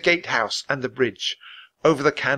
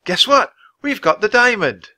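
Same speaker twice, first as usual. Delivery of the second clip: excited call; 9 semitones higher, 6 dB louder than usual. Acted. Annie calls out excitedly.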